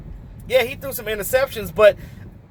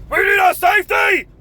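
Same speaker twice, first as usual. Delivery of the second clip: higher, louder exasperated yell; frustrated shout; 10 semitones higher, 4 dB louder than usual. Real, not acted. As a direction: blurt it out, fed up. Ranting about the team's needs.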